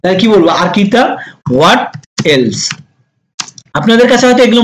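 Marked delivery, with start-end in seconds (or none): 1.5-2.8 spaced-out teaching delivery; word by word. Shown as a demonstration. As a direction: pause between What and else. Teacher models phrase word by word.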